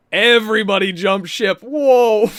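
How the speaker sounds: loud, high excited yell; excited shout. Real, not acted. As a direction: shout it out in real shock. Sudden market spike, yelling out.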